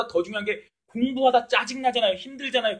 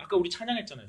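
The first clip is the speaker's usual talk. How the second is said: usual speaking voice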